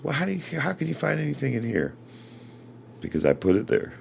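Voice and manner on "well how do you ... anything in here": much higher, frustrated partner voice; wife's exasperated voice. Retold or imitated. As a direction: imitate a frustrated wife, pitched way up. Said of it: "She goes".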